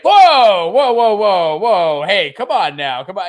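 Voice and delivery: high loud whoa whoa, backing off; mock alarmed whoa. Clergy recoiling at the priest, high alarmed whoa. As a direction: yelp whoa like a crowd recoiling. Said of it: "everyone's just like".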